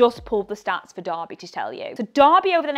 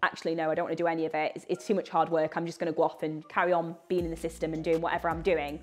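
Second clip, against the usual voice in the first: imagined reluctant attendee; 6 semitones lower, 5 dB quieter, faster than usual. Pretend. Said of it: "come on the two days and go".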